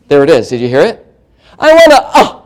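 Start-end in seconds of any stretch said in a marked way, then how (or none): 1.6-2.4 high mock-teen plea breaking into a grunt; choked-off whining. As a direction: whine high and catch in the throat. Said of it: "it's just a sound".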